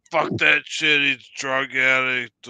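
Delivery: pissed-off grandfather. Retold or imitated. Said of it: "pissed off".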